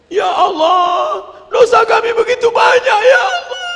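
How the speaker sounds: hammed-up crying supplication; wailing prayer. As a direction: practise weeping out a plea to God. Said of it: "latihan nangis".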